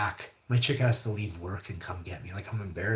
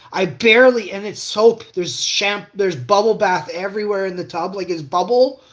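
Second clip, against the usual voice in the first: much higher and louder, building alarm; urgent retelling; 10 semitones higher, 11 dB louder than usual. Real, not acted. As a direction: relive the panic as you tell it. Retelling near-fainting in bath.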